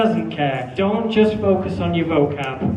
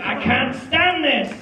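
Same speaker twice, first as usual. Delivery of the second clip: higher, slow emphatic "I can't stand this"; with feeling; 4 semitones higher than usual. Shown as a demonstration. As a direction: make the listener believe it. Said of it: "I wanna see".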